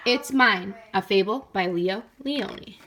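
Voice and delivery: put-on proud title reading for children; title read aloud. A narrator's reading. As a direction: announce the title like a storyteller.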